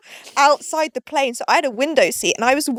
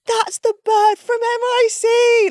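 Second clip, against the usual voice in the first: put-on slow, much higher jeering drunk voice; mock drunk lad; 12 semitones higher, 3 dB louder, slower than usual. Mimicking drunk Brits spotting her at the airport, slow jeering. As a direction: drawl it out like a hammered lad at check-in.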